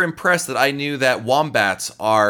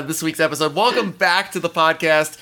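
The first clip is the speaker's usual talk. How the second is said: usual speaking voice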